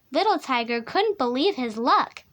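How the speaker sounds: ordinary delivery, nothing marked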